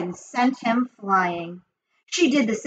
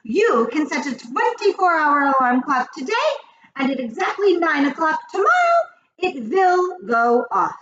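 high, emphatic witch voice; theatrical witch proclamation; triumphant Grand High Witch; 8 semitones higher, 4 dB louder than usual. A narrator's reading. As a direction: explain the scheme grandly, stretching the ending.

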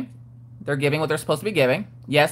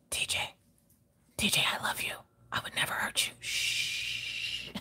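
creepy whisper; 15 dB quieter than usual. Pretend.

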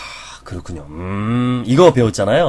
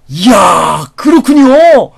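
very high and loud, gushing amazement; loud, exaggerated awe at the good news; over-the-top admiration; 13 semitones higher, 8 dB louder, slower than usual. Shown as a demonstration. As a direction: flatter the boss with huge delight. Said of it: "이렇게 오버해줘야 되는 거예요".

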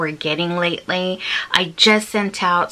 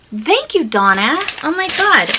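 delighted; 7 semitones higher than usual. Real, not acted. Delighted by an included recipe.

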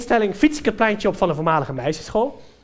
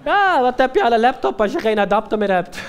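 loud, higher-pitched mocking comeback; brash retort; 4 semitones higher, 6 dB louder than usual. Smoker mocks owner for forgetting the adapter, brash retort. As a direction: throw it back loudly.

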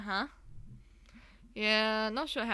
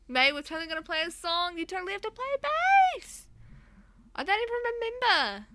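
much higher, bubbly, loud; high excited character voice; 9 semitones higher, 5 dB louder than usual. Acted. Player reading band friends' dialogue, high excited voice. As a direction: voice them like eager cartoon friends.

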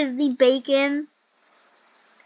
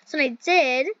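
ordinary talk

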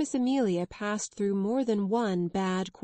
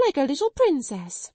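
higher and louder, falling at the end; hysterical outburst; 6 semitones higher, 4 dB louder than usual. Acted. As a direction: let it burst out. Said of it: "her hysterics got the better".

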